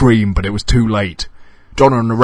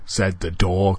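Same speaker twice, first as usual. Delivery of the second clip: usual speaking voice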